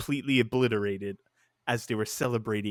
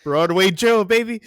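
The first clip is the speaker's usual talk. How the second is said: a loud, high, tipsy hint of the quarterback; hammed-up drunk celebration shout; drunk Broadway Joe; 9 semitones higher, 9 dB louder than usual. Mocking the drunk Jets quarterback on live TV, loud and high. He's, like, slurring his words.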